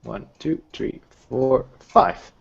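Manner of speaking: ordinary talk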